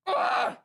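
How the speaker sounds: sharp, high breathy "Ugh!" burst; frustrated ugh. Real, not acted. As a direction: blurt out an exasperated, voiceless groan. Overwhelmed by the scene.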